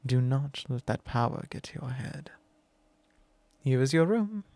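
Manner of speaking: normal talking voice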